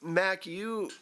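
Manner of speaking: ordinary talk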